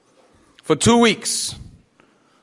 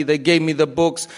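ordinary talk

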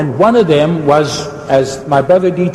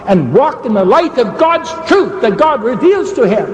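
fervent preaching; 6 semitones higher than usual. Real, not acted. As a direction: preach it with rising fervor. Preaches an appeal to listeners.

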